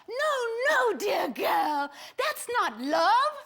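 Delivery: high, drawn-out "no, no"; hammed-up, high-pitched admonishing; theatrical correction. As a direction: set her straight with big swooping emphasis. Acted correction of a lovestruck girl.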